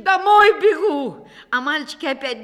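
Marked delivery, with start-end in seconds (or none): 0.0-1.3 loud, higher, slow yell; shout. A narrator's reading. Akim shouting back to his sons. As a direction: bellow it while running. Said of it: "кричит".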